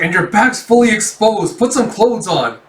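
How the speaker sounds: hammed-up exasperated order; acted scolding. Acted scolding of exposed friend.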